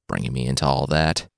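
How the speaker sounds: usual speaking voice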